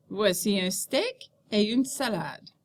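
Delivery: "steak" up as unfinished, "salade" down as the close; rising mid-sentence intonation. Shown as a demonstration. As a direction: voice rises on "steak", then falls on "salade". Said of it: "your voice rises after each group of words".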